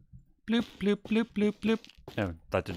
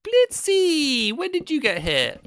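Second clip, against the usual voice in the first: very high, loud surprised question; tiny high cartoon voice; squeaky voice; 6 dB louder, slower than usual. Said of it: "do multiple voices".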